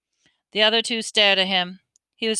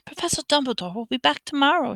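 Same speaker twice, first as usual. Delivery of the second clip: higher, clipped teacher voice; McGonagall, final; 5 semitones higher, faster than usual. A narrator's reading. McGonagall dismissing Harry, lightly voiced stern teacher. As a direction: close the matter as the stern professor. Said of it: "she said finally".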